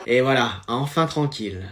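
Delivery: low, soft sigh of relief; relieved. Acted. Twin once the supervisor is gone, quietly relieved.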